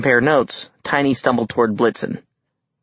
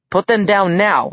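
firm demand; 8 semitones higher than usual. Acted. Demanding release of captives.

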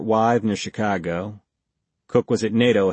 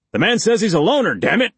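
fast, louder angry snarl; 11 semitones higher, 5 dB louder, faster than usual. A narrator's reading. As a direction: snap it out in anger. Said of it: "Blake snarled".

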